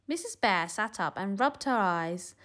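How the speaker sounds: usual speaking voice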